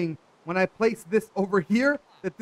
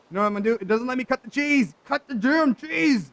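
rising from raised voice to high shout; building frustration; 5 semitones higher, 5 dB louder than usual. Real, not acted. Player raging at cheese that won't cut, building frustration. Frustration at glitch boils over.

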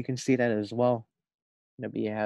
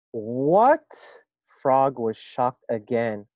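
lively storybook reading voice; reading to children; 10 dB louder, slower than usual. A narrator's reading. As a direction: read it aloud to a class. Reads story aloud to first graders.

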